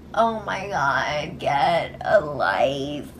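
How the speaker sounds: slow, low exasperated drawl. Real, not acted. Host scoffs at the houseguests' insults, exasperated. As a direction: drag it out, low and fed up. Scornful reaction to the quarrel.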